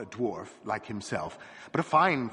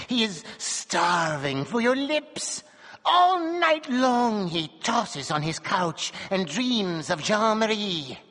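shrill voice; 10 semitones higher than usual. A narrator's reading. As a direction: plead like a pin scratching glass. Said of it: "in a voice as shrill as a pin".

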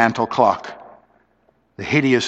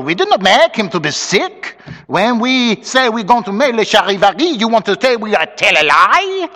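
put-on high-pitched Creole French accent; French-accented outraged question; tiny Creole's cry; 8 semitones higher than usual. A narrator's reading. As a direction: protest indignantly as a little Creole. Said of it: "cried a tiny Creole".

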